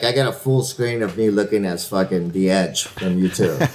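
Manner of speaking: ordinary delivery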